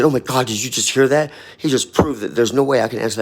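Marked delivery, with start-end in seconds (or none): 0.0-1.3 calling out to crowd. Retold or imitated. Turns to the crowd on the train.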